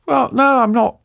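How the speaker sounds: retold high-pitched attempt at a straight face; trying not to laugh. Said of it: "I'm trying to keep a straight face".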